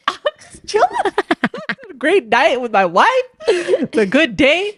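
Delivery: slow, high imitation of a content guy; oblivious husband. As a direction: play the happy clueless husband. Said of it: "And he's like".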